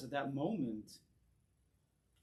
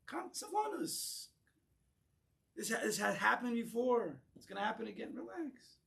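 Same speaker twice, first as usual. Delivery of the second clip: exaggerated soothing; 7 semitones higher than usual. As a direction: coo at him like he's a baby. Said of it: "what you would tell the guy".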